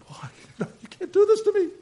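high, loud, laughing disbelief; amused apostle chuckling at his persecutors; laughing Paul. Retold or imitated. Paul voiced laughing at his trials.

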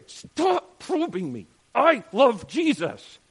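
a defensive churchgoer, loud and high; defensive protest. Retold or imitated. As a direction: snap back defensively, dragging it out. Defensive believer voiced.

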